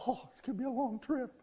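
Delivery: high, quick eye-rolling groan; exasperated parent. Acted. Parent on a car trip rolls eyes, exasperated. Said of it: "We roll our eyes and say".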